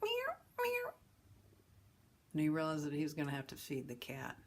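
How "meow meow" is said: high mewing cat cries; plaintive cat meowing; cat meows. Retold or imitated. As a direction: mew like a hungry cat. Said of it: "he would hear meow".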